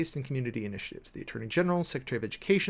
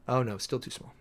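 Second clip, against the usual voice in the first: usual speaking voice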